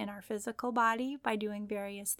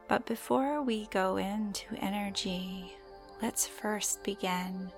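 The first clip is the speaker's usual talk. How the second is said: guided meditation; slower than usual. Acted. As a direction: gently guide the listener into calm.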